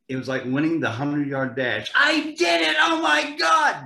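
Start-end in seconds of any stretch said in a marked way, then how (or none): 1.9-3.8 high, loud triumphant yell; elated victory shout. Retold or imitated. Actor recalling his first screen kiss, shouting in triumph. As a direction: cheer like you just won the dash.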